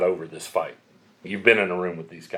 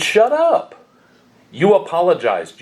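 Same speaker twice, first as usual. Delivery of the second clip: much higher, loud "Shut up", still loud after; retold shout; 9 semitones higher, 7 dB louder, slower than usual. Pastor stopping the wife's justifying, loud high shout. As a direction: shout her down mid-explanation. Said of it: "and I said".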